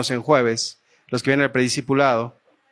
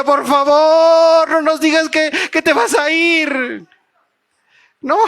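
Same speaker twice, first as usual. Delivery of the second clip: much higher and louder, very slow plea; pleading Ruth; 12 semitones higher, 4 dB louder, slower than usual. Retold or imitated. Ruth begging her mother-in-law not to go, slow high plea. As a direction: beg her not to leave.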